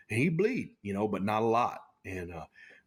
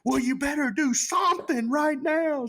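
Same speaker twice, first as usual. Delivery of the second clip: much higher, louder demanding yell; urgent shout; 5 dB louder than usual. Retold or imitated. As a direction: order him loudly to act now. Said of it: "And he just goes".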